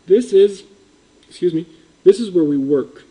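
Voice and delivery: normal talking voice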